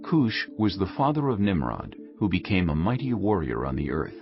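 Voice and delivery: normal talking voice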